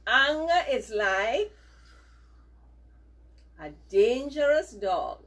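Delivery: slow and loud, word by word; loud, measured dictation. Shown as a demonstration. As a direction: spell it out for the class to repeat. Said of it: "repeat these words after me".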